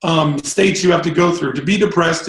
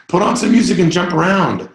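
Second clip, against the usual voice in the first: ordinary talk, nothing marked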